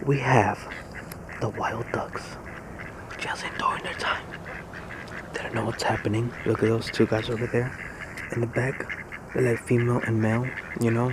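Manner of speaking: hushed mock wildlife documentary; Animal Planet narrator. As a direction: narrate softly so the ducks aren't disturbed. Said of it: "This is Animal Planet, guys".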